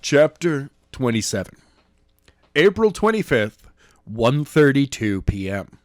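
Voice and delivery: plain reading voice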